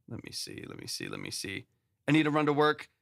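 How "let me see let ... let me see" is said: whispered to himself while checking; whispering. Real, not acted. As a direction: breathe the words under your breath. Muttering while checking the queue.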